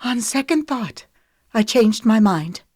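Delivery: snippy. A narrator's reading. Lemon ending the plan with Wesley, curt and snippy. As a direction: snap it off curtly. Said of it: "Lemon snipped".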